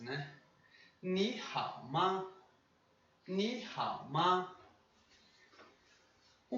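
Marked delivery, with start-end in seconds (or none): 1.0-4.5 Ni hao ma said slowly and carefully; slow Mandarin phrase. Shown as a demonstration. Teacher presenting the new phrase slowly. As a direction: model Ni hao ma syllable by syllable. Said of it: "vamos ver essa segunda frase".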